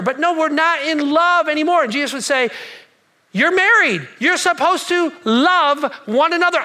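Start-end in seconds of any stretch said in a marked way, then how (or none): 0.0-1.8 protesting spouse. Acted. Couple protesting to Jesus. 3.3-6.6 insistent. Acted. Jesus insisting to the couple.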